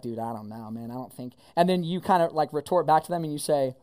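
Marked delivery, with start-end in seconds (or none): 0.0-1.3 mock doubtful mumble. Doubting friends voiced.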